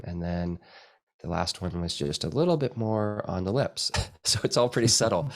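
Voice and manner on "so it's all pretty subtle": amused, with a real laugh. Real, not acted. Teacher amused by his own tiny retouches, laughing. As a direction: laugh at yourself as you say it. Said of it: "I guess I'm laughing".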